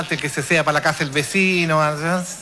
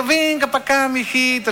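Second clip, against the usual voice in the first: higher, sweetly coaxing mom voice; mother sweet-talking the dog, higher and coaxing; 7 semitones higher than usual. Retold or imitated. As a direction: coax the dog in gently like mom would. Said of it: "y así suavecito".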